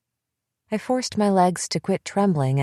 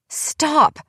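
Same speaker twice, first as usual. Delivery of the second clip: very high, loud desperate scream; 10 semitones higher, 4 dB louder than usual. Acted. Emily screaming at Bethy to stop, desperate scream. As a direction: shriek it to end the fight. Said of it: "she screamed".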